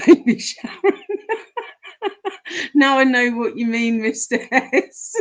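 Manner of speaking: high, helpless laughter through the words; laughing. Real, not acted. Streamer tickled by a viewer's Baby Sharon joke, laughing. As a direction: crack up loudly while talking.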